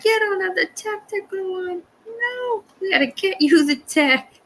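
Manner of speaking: high squeaky mimic of a viewer; high teasing mimic; squeaky mock viewer. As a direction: pipe it up like a whiny guest.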